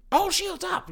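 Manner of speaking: very high, strained mock shout; Hux. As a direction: panicky officer relaying an order, hammed up. Hux relaying the order.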